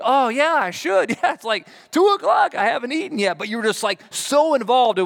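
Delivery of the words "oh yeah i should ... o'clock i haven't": high, loud oh-yeah of someone who forgot to eat; sudden realization. Pretend. Busy worker told to eat, suddenly realizing. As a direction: catch yourself remembering lunch.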